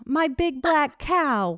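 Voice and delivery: put-on slow, drawn-out proud kid voice; a son proudly announcing his cow, slow and drawn out. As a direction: stretch it out like a kid showing off. Son proudly reveals BBC meaning.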